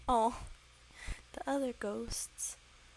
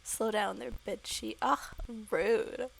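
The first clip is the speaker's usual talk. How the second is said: ordinary delivery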